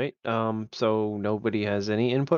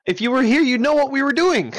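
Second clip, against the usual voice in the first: loud, much higher reproach; exasperated scolding; 13 semitones higher, 6 dB louder than usual. Real, not acted. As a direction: tell off someone who wasn't paying attention. Scolding inattentive player for asking.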